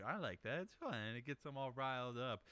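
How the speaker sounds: normal talking voice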